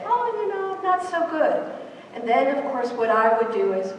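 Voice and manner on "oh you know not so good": high, hesitant admission; confiding adult. Acted. Adult responding to a caring colleague, high-pitched confession. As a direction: let it out reluctantly in a raised pitch. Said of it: "respond to me and say".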